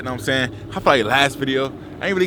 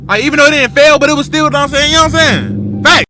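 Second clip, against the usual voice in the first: higher, much louder hype; hyped up; 13 semitones higher, 10 dB louder than usual. Real, not acted. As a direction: pump the energy way up. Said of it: "I'm gonna be hyped".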